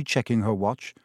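usual speaking voice

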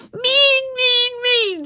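very high, loud chant on a beat; rhythmic yelling. A narrator's reading. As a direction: yell each word in time with kicking heels. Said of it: "she yelled in time to her drumming heels".

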